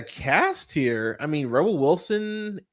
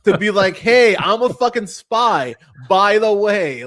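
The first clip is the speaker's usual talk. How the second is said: higher and louder, scolding a liar; exasperated rant; 6 semitones higher, 7 dB louder than usual. Retold or imitated. Reviewer scolding the spy friend, mock exasperated shout. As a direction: tell off the friend who kept a secret. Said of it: "It's like".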